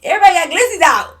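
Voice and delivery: high, loud alarmed call; mock shout. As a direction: belt out the warning. Shouting warning about guns.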